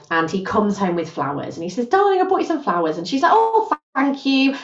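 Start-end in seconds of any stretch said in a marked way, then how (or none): 1.9-3.0 high, lilting sweet talk; sweet-talking partner. Acted. Sweet partner voiced in a story. 3.4-4.5 slow, high, drawn-out thanks; touched gratitude. Acted. Delighted woman voiced in a story.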